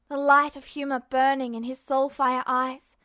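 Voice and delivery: usual speaking voice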